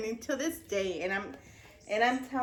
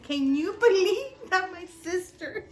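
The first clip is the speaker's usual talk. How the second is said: laughing; 9 semitones higher than usual. Real, not acted. Laughing while quoting call.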